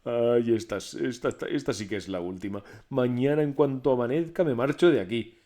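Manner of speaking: loud frightened stammer; terrified Pedro. A narrator's reading. Terrified Pedro vows to leave at dawn, loud. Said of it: "Pedro, asustadísimo".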